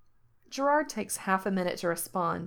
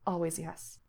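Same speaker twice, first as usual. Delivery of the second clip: tender murmur; 6 dB quieter than usual. Acted. Gerard's tender reply.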